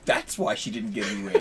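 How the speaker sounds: Miko. Acted. Character walking away with a parting jab, low muttered aside. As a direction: drop the pitch right down, amused undertone. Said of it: "Miko walks away going".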